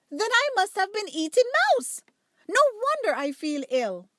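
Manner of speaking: a sudden gasp of discovery; startled realization. Acted. Duchess realizes she ate mouse.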